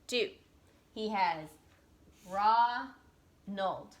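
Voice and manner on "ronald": "Ronald" as long rah, pause, nold; split into syllables. Shown as a demonstration. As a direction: clear gap inside "Ronald". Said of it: "So two syllables in his name".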